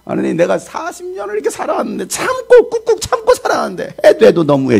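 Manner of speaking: high-pitched, wailing grievance to God; acted high, indignant lament; aggrieved complaint. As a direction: protest like long patience finally running out. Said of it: "이런 말 많이 해보죠".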